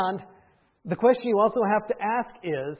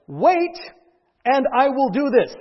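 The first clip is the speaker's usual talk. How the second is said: loud, raised-voice order; firm command; 4 semitones higher, 6 dB louder, slower than usual. Acted. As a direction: lay down the instruction emphatically. Quotes priest's specific command.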